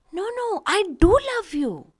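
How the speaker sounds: protest, leaning on do; stress on do. Shown as a demonstration. Teacher reassuring a doubter, stress on do. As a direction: do pressed hard, voice lifted. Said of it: "I want to emphasize the fact that I love you".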